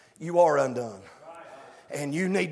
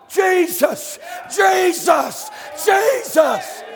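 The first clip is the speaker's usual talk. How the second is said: ringing high shouts; shouted name; 11 semitones higher, 5 dB louder, much slower than usual. Real, not acted. Preacher crying out the name to the church, loud. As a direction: call the name out three times.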